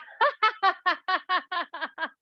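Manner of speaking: high, rolling ha-ha-ha; popped-bubble laugh. Shown as a demonstration. Teacher popping the bubble, bursting laugh. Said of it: "Pop that bubble and laugh".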